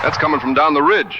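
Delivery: rally them to ride now; urgent shout. Acted. Davy and Russ shouting to ride toward the howl. Howl heard, rushing to help.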